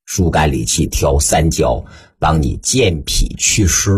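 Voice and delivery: slow, low-pitched guiding voice with long pauses; guided breathing instruction. Shown as a demonstration. Teacher leading a student through slow breathing, guided exercise voice.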